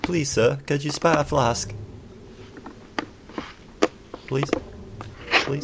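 begging. Pretend. Begging partner for a flask.

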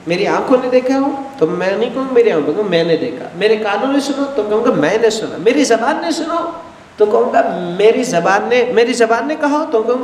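ordinary delivery

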